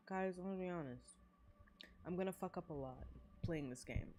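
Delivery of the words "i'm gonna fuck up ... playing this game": ordinary delivery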